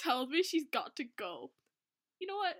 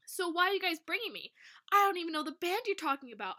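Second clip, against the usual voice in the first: high, curious questioning; 7 semitones higher, 4 dB louder than usual. A narrator's reading. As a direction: ask it with curiosity taking over. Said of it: "curiosity getting the better of me".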